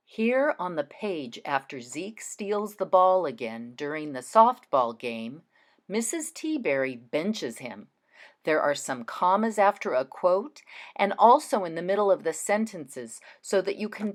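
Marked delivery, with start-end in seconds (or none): none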